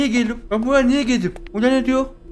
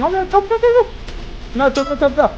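frantic urging; 5 semitones higher than usual. Real, not acted. Racing the countdown.

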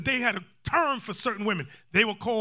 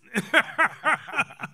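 high, hammed-up sobs; mock sobbing; 7 semitones higher, 3 dB louder than usual. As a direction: imitate manipulative crying. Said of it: "That's what they do".

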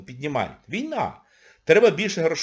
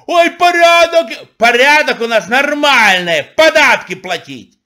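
mocking singsong; 10 semitones higher, 11 dB louder, slower than usual. Mocking ideal country voice.

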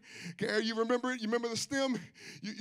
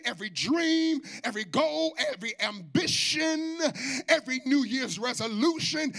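full-force preaching; 3 semitones higher than usual. Acted. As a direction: preach it out, half singing.

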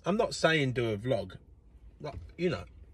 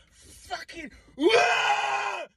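high cry-shout of frustration; exaggerated frustrated wail; mock wail; 4 dB louder, much slower than usual. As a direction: let out a hammed-up howl.

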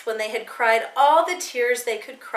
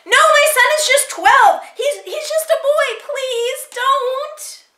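very high, loud plea; desperate crying out; 8 dB louder than usual. A narrator's reading. Mother begging Nazis to spare her son, high desperate cry. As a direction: beg frantically, tripping over the words. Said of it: "Mama cried".

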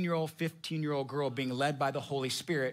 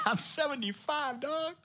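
high laughing disbelief; mock squeaky disbelieving protest; incredulous old Abraham; 7 semitones higher than usual. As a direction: scoff at the idea like it's a joke. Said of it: "And he's like".

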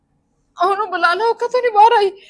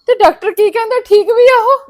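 sudden higher, louder worried questions; alarmed; 6 semitones higher, 7 dB louder than usual. Acted. Mother asking sister-in-law whether fallen Karmo is okay, alarmed. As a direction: jump in with frightened concern.

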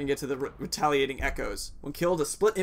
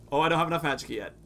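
usual speaking voice

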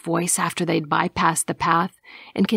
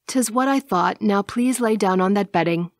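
ordinary delivery

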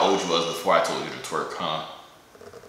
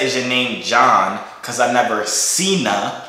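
slow, drawn-out pickup line; slow joke setup; much slower than usual. Shown as a demonstration. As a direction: stretch the setup line out slowly. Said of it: "I said".

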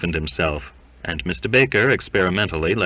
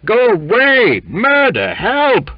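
high, loud cries for help; panicked clamor at full pitch; 8 dB louder, slower than usual. A narrator's reading. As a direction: shriek in alarm, word by word. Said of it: "clamored Jimmy".

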